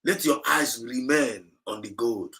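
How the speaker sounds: ordinary delivery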